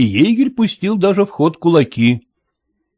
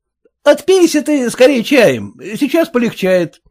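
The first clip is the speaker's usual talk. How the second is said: fussing host; 11 semitones higher than usual. Acted. Host urging hungover guest.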